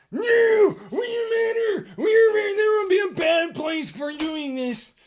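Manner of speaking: high, loud hammed-up protest yell; mock shouting protest. Tribe members yelling their protest, high and loud. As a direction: scream the slogans like a zealot mob.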